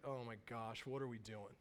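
put-on bored teen muttering objections; sulky teen. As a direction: grumble it like a slouching kid. Voicing the teen Josh.

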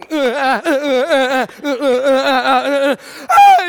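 stammering uh-uh sobs rising into a wail; childish blubbering that cracks up high and loud at the end; imitating a kid crying. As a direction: act out the little boy breaking into tears. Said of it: "he starts to cry".

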